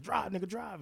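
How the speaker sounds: urgent high shout to drive; hurried call to step on it; retold yell. Friend running to car yelling at driver, high urgent shout.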